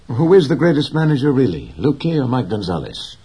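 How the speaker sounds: put-on a keen boy pressing the question; young boy, curious. A narrator's reading. Boy's voice voiced by narrator.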